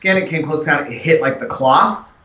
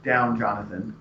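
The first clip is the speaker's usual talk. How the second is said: softer, gentle calming; soothing; 3 semitones lower, 8 dB quieter, slower than usual. Acted. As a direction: settle him down gently. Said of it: "Mina's like".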